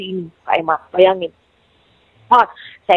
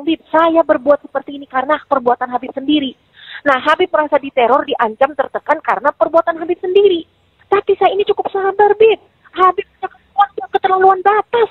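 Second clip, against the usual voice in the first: higher-pitched, indignant retelling of a tirade; retold angry confrontation; 7 semitones higher than usual. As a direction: replay telling him off, pitch climbing. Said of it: "Ngamuk, saya nggak tahu kenapa masuk jin". (menirukan omelan marah dengan nada meninggi)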